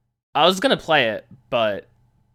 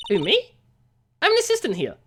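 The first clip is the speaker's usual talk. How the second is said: put-on young woman voice; 7 semitones higher than usual. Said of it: "I can't do Penny's voice".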